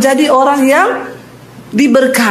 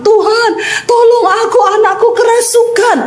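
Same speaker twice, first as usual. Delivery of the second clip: higher, pleading cry for help; desperate crying out; 8 semitones higher than usual. Retold or imitated. Mother begging Jesus to help her possessed child, pleading cry. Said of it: "teriak-teriak".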